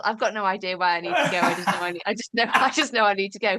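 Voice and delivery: talking through laughter; laughing. Real, not acted. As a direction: giggle at your own mystery. Laughing at herself.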